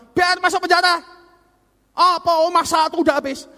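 high, loud indignant outburst; stern father quoted. Acted. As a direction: mimic a dad angrily washing his hands of it. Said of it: "Padahal bapak yang tadi bilang".

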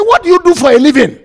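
loud, high, fast question; acted assassin firing off a demand; demanding interrogator. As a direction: fire the question out loudly. Assassin interrogates the captive preacher.

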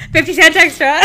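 high, loud cashier patter through laughs; curt impatient cashier. Retold or imitated. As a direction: bark the bag charge like a cashier. Mimicking cashier lines.

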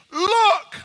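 high ringing shout of "Look". Acted. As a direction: cry out "Look" to the crowd. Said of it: "John just shouts with an exclamation".

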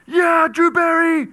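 high, slow stadium shout; fan cheer. Retold or imitated. Preacher acting out cheering for the Saints, then the shout. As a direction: yell it like a fan in the stands. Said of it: "If I can go".

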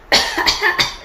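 short acted gagging cough. Teapot choking on paint fumes, gagging cough.

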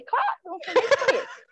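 high, laughing fake denial. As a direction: laugh while playing innocent. Jokingly covers for the source. (negando entre risos)

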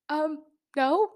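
high-pitched, bewildered little answer; retold confusion. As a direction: answer as if baffled by the question. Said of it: "I was just so confused".

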